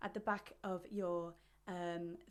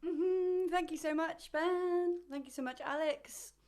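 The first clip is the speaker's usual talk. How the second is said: bubbly thanks; 9 semitones higher, 6 dB louder, slower than usual. Real, not acted. Bright loud high thanks.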